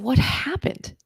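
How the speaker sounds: breathless whispered question, rising. Retold or imitated. To her relapsed friend, a stunned whisper. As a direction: whisper it in shock.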